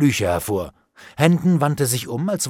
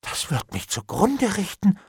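groaning; 9 semitones higher than usual. A narrator's reading. Bailiff faces ruin, groaning in dismay. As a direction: moan it in dismay. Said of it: "stöhnte der Büttel".

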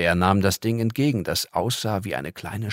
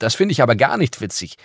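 loud, rising, faster furious scolding; angry; 6 semitones higher, 8 dB louder, faster than usual. Acted. Girl scolding the visitor for mocking her, angry and loud. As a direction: tell her off, climbing higher to the end. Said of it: "rief sie wütend".

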